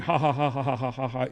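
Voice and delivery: mimicked run of even ha-has; mock laugh in a steady string; imitated laughing. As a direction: imitate a mechanical, repeated laugh. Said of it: "you were laughing".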